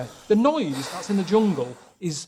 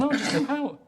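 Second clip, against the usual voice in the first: mocking quote; 9 semitones higher than usual. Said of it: "you're saying".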